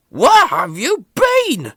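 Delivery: high, loud and slow, very cross; stern boss scolding; cross Fat Controller. A narrator's reading. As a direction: demand an answer from a late engine. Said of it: "he asked".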